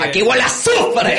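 loud high mock Chávez; Chávez impression. A Venezuelan doing Chávez for the foreigners, loud and high. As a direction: boom it out like Chávez at the UN.

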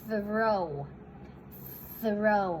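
"throw" with airy TH blended into R; careful TH-R pronunciation. Shown as a demonstration. Teacher answers a viewer's request, careful TH-R on "throw". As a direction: model "throw" carefully, tongue out for TH. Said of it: "เรื่องการออกเสียงคำว่า".

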